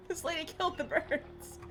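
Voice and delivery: high-pitched repeat, laughter around it; high, giggly disbelief; amused disbelief. Real, not acted. Repeats outburst softer, amid laughter.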